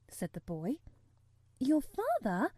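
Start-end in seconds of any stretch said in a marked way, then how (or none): 1.6-2.5 higher-pitched startled question; mild astonished gasp in a girl's voice. A narrator's reading. Mary learning he is her uncle's son, gasping. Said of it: "gasped Mary".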